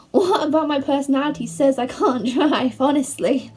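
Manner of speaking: amused disbelief. Real, not acted. Vlogger questions why people think she can't drive, laughing. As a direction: protest through laughter, incredulous. Baffled that people doubt her driving.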